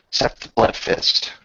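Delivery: ordinary delivery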